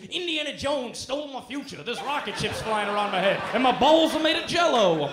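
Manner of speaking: unhinged rant that ends in a shout; crazed ranting. Retold or imitated. Subway homeless man raves at comedian, rising to a shout. Homeless guy rants nonsense.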